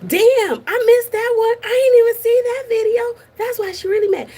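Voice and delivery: loud, high-pitched shock at the news. Real, not acted. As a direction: gasp it out in disbelief. Shocked reaction to clip.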